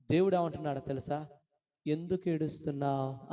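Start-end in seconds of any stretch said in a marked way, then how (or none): 1.9-3.3 gentle question. Acted. God asks the weeping believer, gentle question. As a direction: ask it tenderly of someone weeping. God's question asked tenderly.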